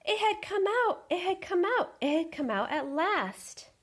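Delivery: gasp, then high loud thrilled cry; excited gasp. Acted. Goose finds the car empty, excited gasp and cry. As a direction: burst out with delight.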